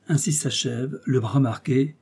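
usual speaking voice

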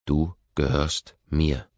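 slow, loud, possessive command; whip-crack words. A narrator's reading. Possessive lover claiming his girlfriend, slow loud whip-crack words. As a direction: crack each word like a whip. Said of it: "Meine Worte durchschneiden die Luft wie Peitschenknallen".

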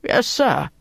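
startled. A narrator's reading. Sergeant answering his scolding officer, startled. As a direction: answer as if caught off guard. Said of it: "The sergeant was startled".

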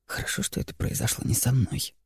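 low, hushed inner voice; private thought. A narrator's reading. Student secretly relieved at a woman's misfortune, private thought. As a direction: drop down low, as if thinking to oneself. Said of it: "у него промелькнула мысль".

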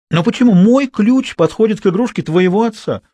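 put-on high, insistent girl's voice; challenging question. A narrator's reading. Girl challenges the boy about her key, insistent. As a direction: demand it, pitched well up.

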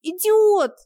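high, loud furious shout. A narrator's reading. Locked-in wife screams at husband, furious shout. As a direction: scream it through the locked door. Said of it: "закричала я".

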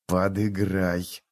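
soft, slow hiss from the corner of the mouth; hissed aside. Acted. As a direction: hiss it so the guards won't hear. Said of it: "прошипел он уголком рта".

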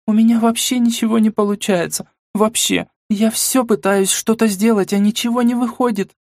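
сквозь рыдания; всхлипывая, в отчаянии; рыдая. Acted. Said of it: "продолжая рыдать". (рыдающий голос)

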